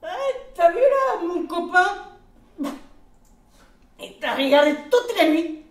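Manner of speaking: high, loud, pushy groom voice; cheeky groom. Acted. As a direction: egg her on like a rowdy wedding guest. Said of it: "lui dit".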